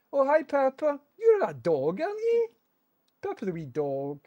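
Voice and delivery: high, sing-song pet voice rising up at the end. Real, not acted. Owner greets the other dog Pepper in a high, sing-song pet voice. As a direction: coo up at the little dog.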